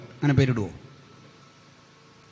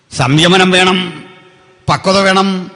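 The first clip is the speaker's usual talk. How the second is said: loud, high preacher's demand; emphatic exhortation; 7 semitones higher, 10 dB louder, slower than usual. Real, not acted. Preacher tells leaders to stay composed, emphatic exhortation. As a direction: drive each short phrase home.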